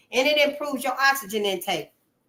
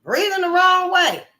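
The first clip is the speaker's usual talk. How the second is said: surprised realization; 8 semitones higher than usual. Retold or imitated. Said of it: "And so I'm like".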